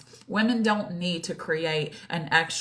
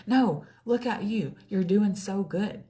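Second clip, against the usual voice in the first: higher-pitched pep talk; encouraging self-talk; 5 semitones higher than usual. Retold or imitated. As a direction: brush off doubt and cheer yourself on. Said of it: "didn't let that thought come back in".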